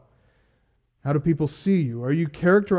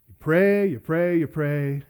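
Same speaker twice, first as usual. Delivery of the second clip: repeated plea dying down to a murmur; fading repetition. Acted. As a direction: let the three prays fade out. Repeated prayer, fading away.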